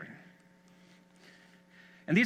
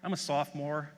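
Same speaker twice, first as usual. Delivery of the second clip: offhand, lower and quieter retelling of a shrug; nonchalant; 4 semitones lower, 5 dB quieter than usual. Retold or imitated. Sophomore telling visiting superintendents his story, nonchalant and offhand. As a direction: toss it off like it's no big deal. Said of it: "very nonchalantly says".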